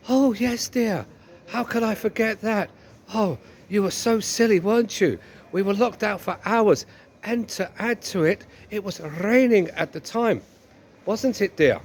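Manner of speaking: hammed-up reproachful wife; long-suffering wife, chiding. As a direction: play a wife rubbing in the blame.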